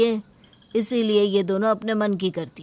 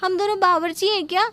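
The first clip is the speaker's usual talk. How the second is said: much higher, indignant grumble; indignant complaint; 8 semitones higher than usual. Acted. Daughter-in-law grumbling to her sister-in-law about the guests, higher pitched. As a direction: scoff at the guests' demands.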